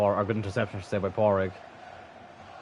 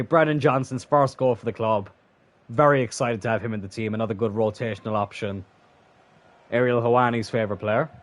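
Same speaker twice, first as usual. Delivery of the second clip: ordinary talk, nothing marked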